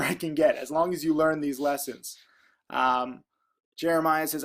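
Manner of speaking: ordinary delivery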